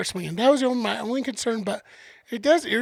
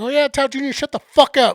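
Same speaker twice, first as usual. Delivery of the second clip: louder mock shout at the crowd; retold comic yell; 4 semitones higher, 7 dB louder than usual. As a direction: bark it at the crying baby's family. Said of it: "He's like".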